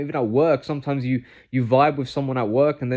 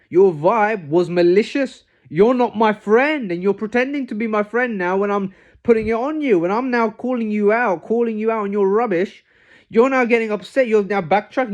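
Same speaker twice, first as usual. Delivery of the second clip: heated callout; 8 semitones higher, 4 dB louder than usual. Real, not acted. Calling out a fake friend for malicious vibes, heated and emphatic. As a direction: lay into them word by word.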